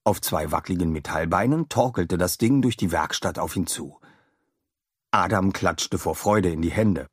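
ordinary delivery, nothing marked

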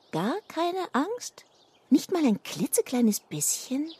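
teasing. Acted. Teasing Kiesel about fear.

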